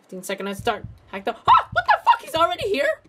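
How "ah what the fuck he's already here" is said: high, loud startled yelp; jolted by a sudden scare. Real, not acted. Player startled as the beast shows up, yelping.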